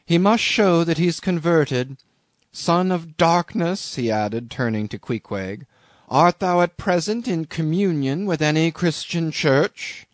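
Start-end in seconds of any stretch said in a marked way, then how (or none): 0.0-4.0 higher, lightly voiced hollow-toned captain. A narrator's reading. Captain demanding proof of conversion, lightly hollow voice. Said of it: "in his hollow voice". 6.1-10.0 lightly voiced solemn captain, pitched higher; hollow voice. A narrator's reading. Captain questioning the harpooner's faith, lightly hollow voice. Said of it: "in his hollow voice".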